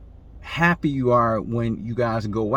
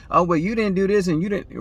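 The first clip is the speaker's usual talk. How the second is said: mock defensive retort, higher; defensive comeback; 7 semitones higher, 3 dB louder than usual. Man firing back at his partner, mock defensive. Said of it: "He's gonna be defensive".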